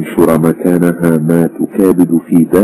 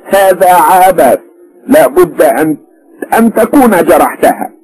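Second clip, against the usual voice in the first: much higher, slow, louder protest; disbelieving mother; 3 dB louder, slower than usual. A narrator's reading. Mother-in-law rejects son-in-law's excuse, louder and slow. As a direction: refuse his story outright.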